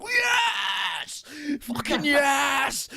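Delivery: very high, loud, drawn-out cheer; ecstatic shout. Retold or imitated. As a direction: yell with pure fan joy. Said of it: "I was just like".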